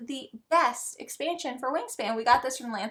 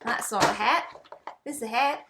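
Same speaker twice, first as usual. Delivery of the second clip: playful Aussie twang; Australian accent. Pretend. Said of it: "I don't know why I keep doing an Australian accent".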